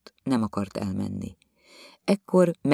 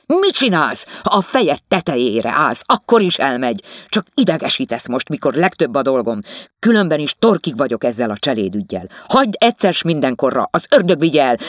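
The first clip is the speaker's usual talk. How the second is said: louder, angry husband; 4 semitones higher, 7 dB louder than usual. Acted. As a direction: shout down the servant quarrel. The quarrel of husband and wife. (dühös férj, emelt hangon)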